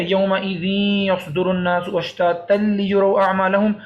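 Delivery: slow recitation merging n into nasal y in یومئذ یصدر; idgham recitation. Shown as a demonstration. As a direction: recite measuredly, joining یومئذ یصدر with a hum. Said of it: "ان دونوں کی آواز ملا کے پڑھنی ہے". (یومئذ یصدر کو غنہ سے ملا کر تلاوت کریں)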